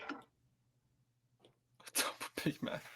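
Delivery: slow, drawn-out whisper. Real, not acted. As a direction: savour each word in a hushed whisper. Mock savoring a Big Mac order.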